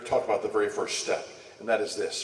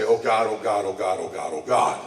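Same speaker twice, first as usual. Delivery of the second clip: repeated pleading oh God, falling lower; desperate prayer; 3 semitones lower, 4 dB louder than usual. Retold or imitated. Showing how to pray mid-conflict, mock desperate pleading. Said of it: "We can say".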